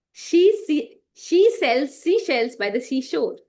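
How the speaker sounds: slow, loud, every word crisp; clear, slow articulation. Shown as a demonstration. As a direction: lay out each word clearly. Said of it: "Every word should be clear".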